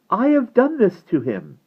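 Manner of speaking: high, loud self-reproach; high, guilt-stricken cry; distressed girl. A narrator's reading. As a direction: confess it high and anguished. Shaking Ariel blames herself.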